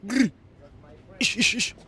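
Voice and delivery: high loud call; calling a pigeon. Acted. Spotting a pigeon in the street, high loud call.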